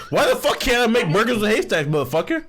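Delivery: high-pitched, indignant protest; indignant comeback. Real, not acted. Teased friend defends his burger making, indignant. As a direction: push back at the teasing, pitch jumping up.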